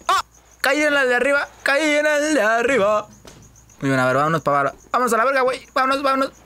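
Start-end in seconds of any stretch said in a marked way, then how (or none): 0.1-3.1 much higher excited cry of relief; high excited yell at the landing; excited shout. Real, not acted. Lands on the upper container. 4.9-6.3 much higher, eager urging; high-pitched eager rallying cry; excited. Real, not acted. Rushing down after landing.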